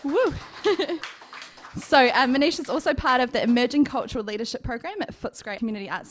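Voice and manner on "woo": high, loud "woo" of admiration; excited whoop. Real, not acted. Host cheering the poet's achievements, excited whoop.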